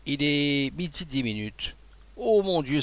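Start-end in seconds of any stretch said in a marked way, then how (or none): 2.2-2.8 high, loud cry of alarm; dismayed cry. A narrator's reading. The abbé realizes he'll miss noon, crying out in alarm. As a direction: cry out, suddenly realizing he's late. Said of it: "s'écria l'abbé".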